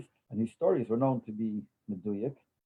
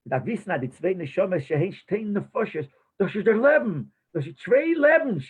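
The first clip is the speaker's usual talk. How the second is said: a lightly voiced old chassid, pitch climbing to higher, emphatic lines; imitating the old chassid; 7 semitones higher, 3 dB louder than usual. Recalling how the mashpia explained Tanya, animated imitation. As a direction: echo an elderly mashpia's animated Yiddish teaching. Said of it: "That's what he used to talk like this".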